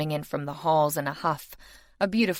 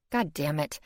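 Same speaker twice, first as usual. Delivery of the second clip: usual speaking voice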